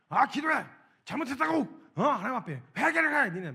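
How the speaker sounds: high, loud scolding commands; preacher imitating himself yelling at the kids; pushy yelling. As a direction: drive them to repent by shouting. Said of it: "엄청 몰아간 다음에".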